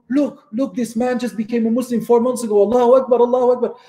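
high-pitched, excited retelling of passers-by; imitated excited bystanders. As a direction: call out the news like an amazed onlooker. Acting out amazed bystanders on the street.

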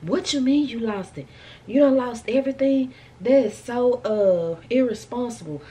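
mock outraged scolding clerk; going off. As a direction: tear into her for losing everything. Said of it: "license office just went off".

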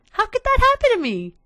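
high, whiny cry of dismay; dismayed wail. Real, not acted. Gamer wailing to viewers over lost cart, high whine. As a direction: wail it like the world just ended.

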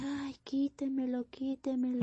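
put-on high, terrified child's plea; high-pitched panicked child; frightened little girl. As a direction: beg like a scared little girl. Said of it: "y empezó, pues, es que".

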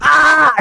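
sharp high scream. Real, not acted. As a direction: let out a startled scream. Scream during gameplay.

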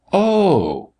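high, drawn-out oh; surprised cry. A narrator's reading. Doctor learning it is the North Pole, surprised. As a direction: let realization swoop up high. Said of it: "cried the doctor".

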